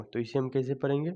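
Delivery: ordinary delivery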